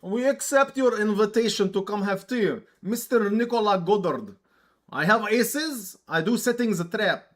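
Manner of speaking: a scheming Russian setting a small trap; Russian dude voice. Acted. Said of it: "Russian dude voice".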